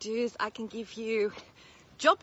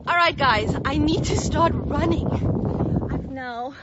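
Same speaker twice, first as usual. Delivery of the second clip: loud and upbeat, ramping up; energetic; 4 semitones higher, 8 dB louder than usual. Real, not acted. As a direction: pump yourself up to run. About to start running.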